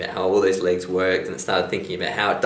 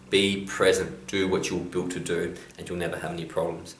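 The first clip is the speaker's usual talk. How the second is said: usual speaking voice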